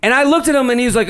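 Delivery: ordinary talk, nothing marked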